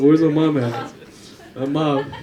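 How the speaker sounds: loud shout through laughter; calling out. Real, not acted. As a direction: call across the room, amused and loud. Calling out to find mom in audience.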